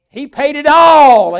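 high, loud and slow proclamation; drawn-out triumphant cry; preaching shout. Real, not acted. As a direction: ring it out to the whole church. Sermon climax.

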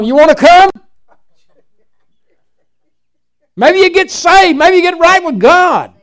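loud, high preacher's taunt; fiery pulpit challenge. Real, not acted. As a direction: throw the challenge at them.

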